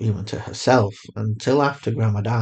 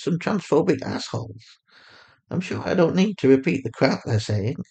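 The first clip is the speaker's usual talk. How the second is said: higher mother voice, calm and steady; Fatima, soothing mother; 7 semitones higher than usual. A narrator's reading. A mother on the phone calmly reassuring her lost daughter.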